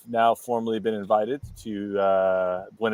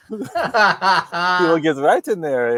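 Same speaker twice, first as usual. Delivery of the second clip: laughing; 7 semitones higher, 6 dB louder, much slower than usual. Pretend. Continues the Dutch bit, laughing.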